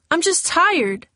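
put-on tired girl snapping at her friend; irritated. A narrator's reading. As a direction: brush it off with exasperation. Denying rumour, irritated.

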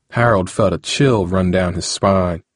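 ordinary delivery

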